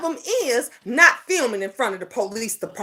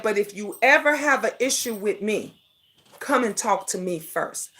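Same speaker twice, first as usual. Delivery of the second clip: softened quote; 6 semitones lower, 6 dB quieter than usual. Retold or imitated. Quoting Fi's straight talk.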